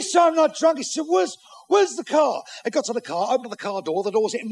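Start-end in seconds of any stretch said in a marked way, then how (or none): 0.2-0.8 put-on high, loud drunken protest; boozy shouted denial; indignant drunken denial. Drunk indignantly denies being drunk. 1.1-2.4 put-on high, loud, slow drunk shout; boozy drawn-out holler; drunken demand. Drunk demands to find his car.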